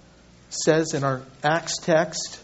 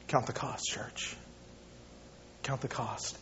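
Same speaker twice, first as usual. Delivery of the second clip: hushed exhortation; 6 semitones lower, 14 dB quieter than usual. Real, not acted. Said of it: "repeated hushed exhortation".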